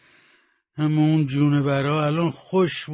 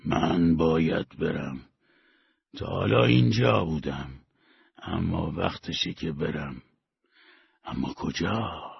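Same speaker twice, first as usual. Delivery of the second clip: inner voice; 8 semitones lower, 3 dB quieter than usual. A narrator's reading. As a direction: let it sound like a voice from somewhere within. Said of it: "صدایی توی سرش گفت".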